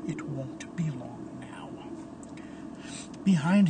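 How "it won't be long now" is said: low, very soft muttering; talking to oneself. A narrator's reading. Phantom paces the bell tower, muttering low to himself. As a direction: murmur it privately, low and hushed. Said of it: "talking to himself".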